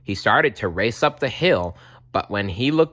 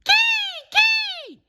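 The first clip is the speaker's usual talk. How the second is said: piercing, very high bird screech; very high hawk call; kestrel cry; 4 dB louder than usual. A narrator's reading.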